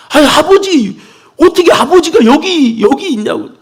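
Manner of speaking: high, disbelieving "아니, 아버지"; astonished son. Acted. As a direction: plead in tearful amazement.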